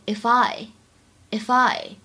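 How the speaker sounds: "if I" run together as one word; linked sounds. Shown as a demonstration. Teacher showing learners how to link "if I", linked. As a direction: link the f of "if" straight into "I". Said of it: "if和I可以选择做连读".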